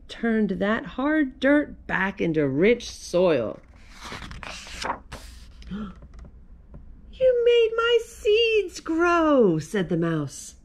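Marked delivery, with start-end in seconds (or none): 4.0-5.3 soft, long acted gasp; amazed gasp. A narrator's reading. Mouse amazed at grown seeds. 5.7-9.6 very high, stretched-out squeal of joy; delighted mouse. A narrator's reading. Delighted mouse thanks Carl.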